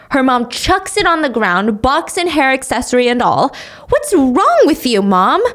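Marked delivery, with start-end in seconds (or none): 3.9-5.4 higher and louder, upset; yelling at mom. Acted. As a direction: shout it at mom in disbelief. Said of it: "Sonny tries to yell at her mom".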